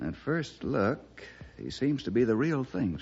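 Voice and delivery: normal talking voice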